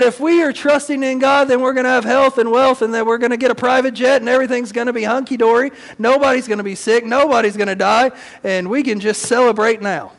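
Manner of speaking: high-pitched hyped TV-preacher parody; mock TV preacher. Pastor mocks TV preachers promising prosperity, high and hyped. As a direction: sell the health-and-wealth promise, mocking. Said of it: "we would hear these T V preachers say".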